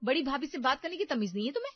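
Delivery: loud, fast furious scolding; shouting at Niharika. Acted. Elder sister-in-law yells at Niharika over insult, loud and fast. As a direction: yell her down, rushing the words. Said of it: "बहुत जोर से चिल्लाती है".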